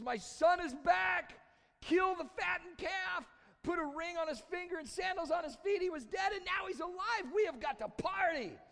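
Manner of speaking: high, loud, overjoyed father calling out; jubilant shout. Acted. Dad calls over the servants to celebrate, jubilant shout. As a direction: proclaim it at the top of your voice.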